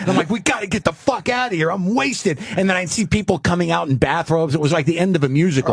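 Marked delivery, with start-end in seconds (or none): 0.3-2.4 high, frantic mock panic; panicked. Panicked retold crash escape.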